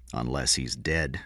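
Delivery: low, slow, soft deadpan; said flatly. A narrator's reading. King answers the lieutenant about the boy, flatly. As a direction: drop it low and toneless. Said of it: "King said flatly".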